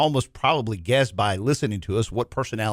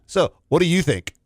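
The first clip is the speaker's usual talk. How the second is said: eager question; 4 semitones higher, 4 dB louder than usual. Shown as a demonstration. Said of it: "This is what, ready?".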